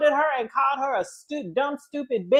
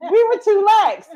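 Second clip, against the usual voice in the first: laughing admission; 10 semitones higher, 5 dB louder than usual. Real, not acted.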